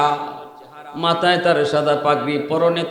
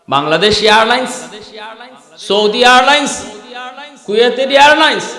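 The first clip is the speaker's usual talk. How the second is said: louder, higher, rising from name to name; fiery preaching; 6 semitones higher, 6 dB louder than usual. Acted. Preacher mocking the idea of airlines to the congregation, fiery and loud. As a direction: preach it out to the crowd.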